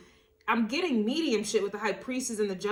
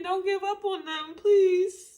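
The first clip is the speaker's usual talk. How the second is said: tearful plea; 8 semitones higher than usual. Retold or imitated. Spirit voice pleading with the reader through tears, high and crying. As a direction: choke up and beg them not to give up. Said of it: "And I keep hearing".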